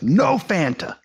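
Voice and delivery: mock the fed-up waitress refusing you; angry waitress. Retelling a waitress in Jerusalem, mock angry waitress voice. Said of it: "really angry".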